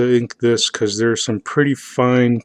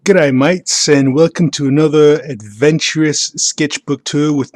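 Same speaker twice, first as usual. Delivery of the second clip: a hammed-up Australian accent greeting; jokey Aussie-accent welcome; bad Australian accent; 3 semitones higher, 3 dB louder than usual. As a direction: play a cheesy Australian host. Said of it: "that bad Australian accent".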